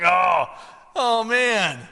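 loud, high groan of disappointment; exaggerated bummed-out reaction; acted dismay. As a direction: moan it in comic letdown. Acted dismay at only bills.

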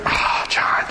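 hushed, sighing reproach; whispered sigh. Retold or imitated. Holy Spirit to the man who lost his temper, whispered sigh.

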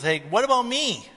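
high, rising whine of protest; acted petulant complaint; jealous brother. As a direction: protest like an overlooked sibling. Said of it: "who was jealous".

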